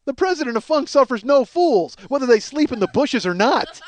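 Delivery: loud, high self-mocking declaration; hammed-up, forceful boast; bombastic rant. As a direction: proclaim it with comic bluster.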